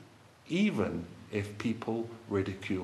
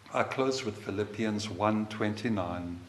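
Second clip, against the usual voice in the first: usual speaking voice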